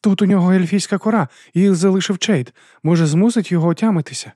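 urgent suggestion. A narrator's reading. Fool urging elfbark for the narrator, urgent. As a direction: rush the idea out.

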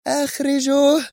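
high, drawn-out command; roaring cry. A narrator's reading. Leader orders the men onward with a roaring cry. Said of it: "بصيحة هادرة".

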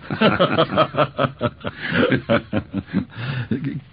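a long, rolling belly laugh, high; long hearty laugh. Acted. Policeman laughing at Dick's story, hearty long laugh.

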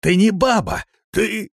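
high, loud, cut-off exclamation; alarmed outburst. Acted. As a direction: cry out as if jumping back from an attacker. Man recoils from his attacker.

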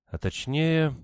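high, thoughtful echo, falling at the end; pondering. A narrator's reading. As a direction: repeat the question while searching memory. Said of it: "Надежда призадумалась". (задумчиво, высоким голосом)

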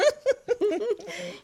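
high giggle trailing down; light laugh. Acted. Joking that neither lady is really old, light giggle. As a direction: giggle at your own little joke. Joke about having no age.

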